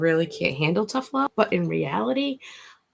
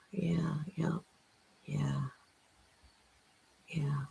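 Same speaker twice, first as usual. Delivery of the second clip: whispering; 4 semitones lower, 12 dB quieter than usual. Acted. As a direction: whisper it like a ritual.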